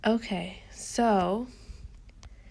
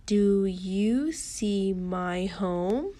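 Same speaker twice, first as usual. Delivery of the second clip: "Do you see my home" drawn out slowly, rising on "home"; stretched read-along question, "home" sweeping up; questioning tune; much slower than usual. Shown as a demonstration. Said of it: "she's asking a question".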